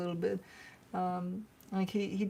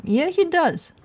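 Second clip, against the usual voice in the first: higher and louder, delighted agreement; excited; 9 semitones higher, 11 dB louder, slower than usual. Real, not acted. Owner to viewers about her dog, excited and much louder. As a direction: burst out happily like he's proud of the dog.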